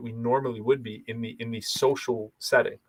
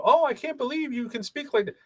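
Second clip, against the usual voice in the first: much higher mock gasp of disbelief; amazed listener; 13 semitones higher than usual. Clubhouse listeners marveling at the speaker, high mock amazement. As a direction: play the stunned Clubhouse listener. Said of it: "people go".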